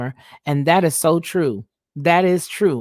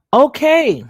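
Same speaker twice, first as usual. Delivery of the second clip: much higher, louder, bright burst of okay; excited okay; 10 semitones higher, 6 dB louder than usual. Real, not acted. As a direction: jump up in pitch like something great just popped up. Sudden excited reaction.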